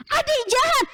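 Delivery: high, sharp accusing cry of a cross big brother; angry child. Acted. Big brother scolds his sister for breaking his castle, angry and high. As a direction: snap at the little sister. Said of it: "Marahnya gimana?".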